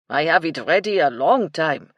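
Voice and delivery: put-on loud Frenchman's heavily accented English; French cook's thick accent, emphatic. A narrator's reading. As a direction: speak up in a strong French accent. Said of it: "in his thick French accent".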